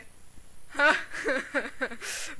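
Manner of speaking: drawn-out laughter; long laugh. Acted. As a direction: laugh at the other's plight. Laughs after sending prisoner away.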